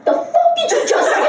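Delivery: high-pitched indignant challenge; high, affronted outburst; indignant retort. Retold or imitated. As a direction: demand it like an offended little tough guy. Four-year-old's outraged retort.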